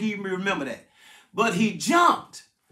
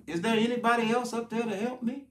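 doubtful response; 5 dB quieter than usual. Acted. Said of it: "listen to his response".

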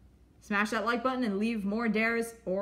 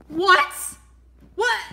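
shocked what; 9 semitones higher, 9 dB louder than usual. Acted. Shocked reaction.

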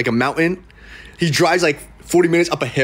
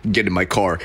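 low deadpan order; 9 semitones lower than usual. Retold or imitated.

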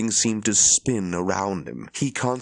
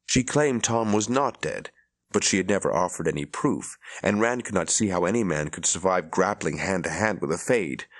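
usual speaking voice

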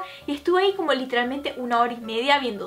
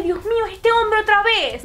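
higher, drawn-out retold dismay; retold alarm; 7 semitones higher, 4 dB louder, slower than usual. Woman recognizes the stranger's voice behind her, retold alarm. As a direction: recoil at recognizing him, voice raised in pitch.

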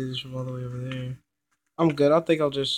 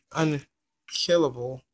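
slow emphatic unkillable; slow, drawn-out; slower than usual. Shown as a demonstration. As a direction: stretch out unkillable slowly. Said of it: "As I said".

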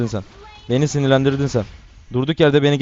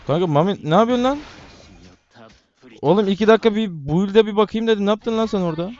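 exasperated disbelief; 7 semitones higher than usual. Real, not acted. Teammate's bad play, exasperated.

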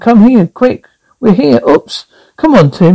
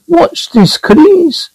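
dummy voice; 11 semitones higher, slower than usual. Acted. Ventriloquist dummy performing for kids.

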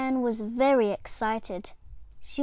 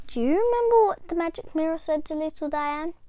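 usual speaking voice

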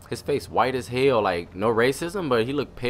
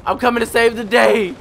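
hyped; 9 semitones higher, 6 dB louder than usual. Real, not acted. Player rushes to rescue teammate.

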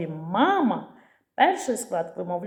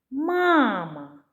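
"Мама" called out, higher, first syllable held long; calling the word; 4 semitones higher than usual. Shown as a demonstration. Teacher showing how to find stress, calling out "Мама" with a long first syllable. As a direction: call "Мама" as if summoning, stretching MAA. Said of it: "необхідно слово погукати".

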